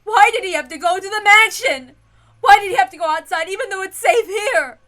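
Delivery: high, loud, desperate cries; frantic pleading. Acted. Ava demanding why her husband left, frantic. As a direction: demand answers in panic. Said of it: "emotions explode".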